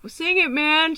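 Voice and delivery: high, loud mock drunken urging; drunk friend. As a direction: imitate a tipsy friend egging you on.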